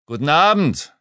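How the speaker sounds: forced-casual hearty hello; chummy. A narrator's reading. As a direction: hail a workmate like an old pal. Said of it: "versuchte ein kumpelhaftes".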